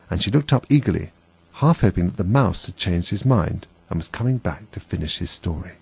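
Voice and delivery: ordinary delivery, nothing marked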